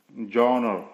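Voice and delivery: जॉनर as "zhaw-nar", first vowel open; demonstrated pronunciation. Shown as a demonstration. Teacher modelling the borrowed word, जॉनर with open first vowel. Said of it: "ई की आवाज़ आ जैसी आ रही है".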